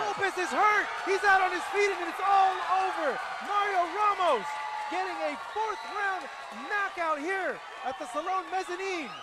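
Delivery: high, loud, excited play-by-play; excited knockout call. Real, not acted. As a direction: call the knockout at full pitch. Knockout call in live fight.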